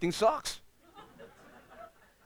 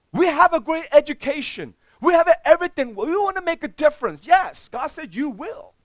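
loud, high fervent preaching; a loud, soaring sermon climax; preaching at full force; 12 semitones higher, 12 dB louder than usual. Acted. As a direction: build to a fervent peak.

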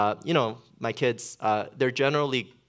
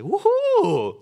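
high teasing woohoo, much louder; mock cheer; 9 dB louder than usual. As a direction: cheer her C to mess with her. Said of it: "trying to mess with her".

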